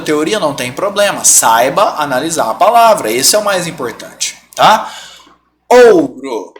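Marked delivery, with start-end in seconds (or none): 5.7-6.5 Ouro with a strong first O and the U barely heard; stressed O. Shown as a demonstration. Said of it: "Qual que é o som que destaca mais?". (Ouro, com o primeiro O forte)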